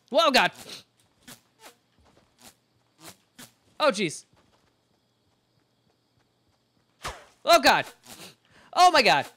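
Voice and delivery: loud panicked high screams; screaming while falling. Real, not acted. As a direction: yell in real terror, full voice. Character falls, then chased.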